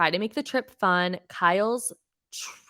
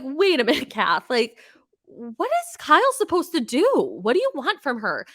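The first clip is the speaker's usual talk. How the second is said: high, loud mock protest; exasperated objection; 6 semitones higher, 4 dB louder than usual. Viewer challenging Kath in a retold reaction, exasperated high protest. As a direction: protest in disbelief, pitch jumping up on the questions.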